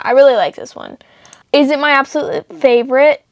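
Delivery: ordinary delivery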